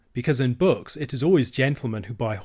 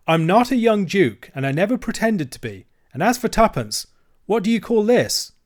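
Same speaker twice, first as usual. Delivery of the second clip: higher, louder indignant retort; angry boy; 8 semitones higher, 5 dB louder than usual. Acted. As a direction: stand up to the bully. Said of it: "he was very angry".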